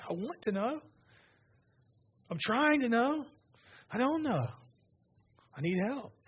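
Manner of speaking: high-pitched, exasperated plea for help; acted frustrated pleading. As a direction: plead as someone trying and failing. Acting congregant who cannot hear God.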